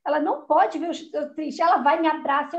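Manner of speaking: normal talking voice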